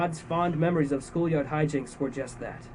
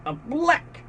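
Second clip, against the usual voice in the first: penguin squawk; 12 semitones higher than usual. Acted. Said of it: "And let out".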